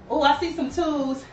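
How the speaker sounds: normal talking voice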